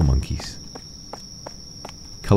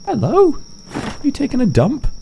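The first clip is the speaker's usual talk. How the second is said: cutesy voice. Pretend. Addressing the cat.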